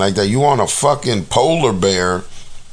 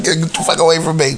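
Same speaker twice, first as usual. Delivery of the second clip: quick, higher "get the fuck away from me"; mock yell at the bear; 7 semitones higher, faster than usual. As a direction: shoo the bear off, fast. Imagined yelling at the bear.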